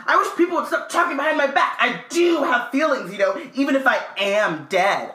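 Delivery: put-on high, fast, wounded ghost-girl whine. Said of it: "I am Myrtle".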